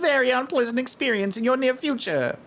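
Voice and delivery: mildly voiced scheming fortune teller; sly fortune teller. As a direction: tease him with a prediction of doom.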